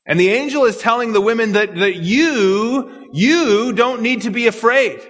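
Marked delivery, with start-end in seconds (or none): 1.8-5.0 "you" repeated, stretched and pitched higher; stress on YOU. Shown as a demonstration. Angel's word to the women, "you" emphatically stressed. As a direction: lean hard on "you", singling out the listeners. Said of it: "his emphasis then separates the women".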